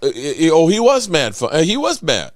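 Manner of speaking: ordinary talk, nothing marked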